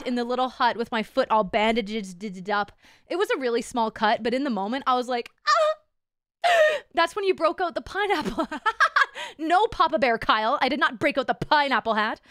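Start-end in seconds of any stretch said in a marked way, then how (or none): 5.5-6.5 mock pained cry. Feeling the small cut.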